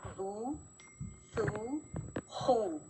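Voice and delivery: बू, सू, खू with the oo held long; long oo sounds. Shown as a demonstration. Teacher reading the vav madda column to students. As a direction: stretch each oo out. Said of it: "ये वाव मद्दा है".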